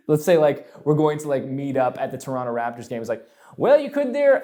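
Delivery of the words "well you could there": put-on high, loud stab at a Southern accent. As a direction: slip into a Southern accent by mistake. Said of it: "no, that's the Southern voice".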